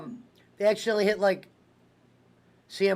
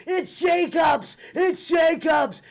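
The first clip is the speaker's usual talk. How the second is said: slow, high shouted announcer call; wrestling commentator; 9 semitones higher, 3 dB louder, much slower than usual. Pretend. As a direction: bellow it like Jim Ross. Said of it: "And have Jim Ross do it".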